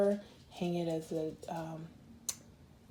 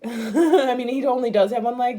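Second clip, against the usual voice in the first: much louder laughing words, higher; 6 semitones higher, 12 dB louder than usual. Real, not acted. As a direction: laugh through it at the silly rooster. Laughing at one-legged rooster.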